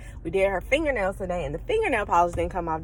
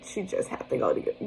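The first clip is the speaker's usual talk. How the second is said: softer, whispered self-talk; muttered to herself; 5 semitones lower, 9 dB quieter than usual. Retold or imitated. Tired mom talking herself through, muttered. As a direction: mutter it under your breath. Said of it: "I just was like".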